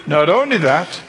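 ordinary talk, nothing marked

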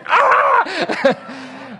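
loud, high comic scream; exasperated scream. Retold or imitated. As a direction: explode in a wordless yell of frustration.

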